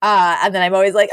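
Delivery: ordinary talk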